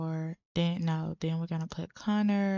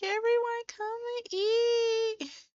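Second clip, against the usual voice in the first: very high, drawn-out singsong call; put-on high hostess call; calling to dinner; slower than usual. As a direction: call everyone to the table, high and stretched. Sim calling guests to the meal.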